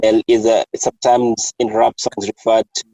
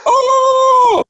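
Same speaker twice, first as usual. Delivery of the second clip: mock mortified denial; 4 dB louder than usual. Friend realising her slip before colleagues, mock mortified. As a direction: imitate a squealed, embarrassed backtrack. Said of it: "And she's like".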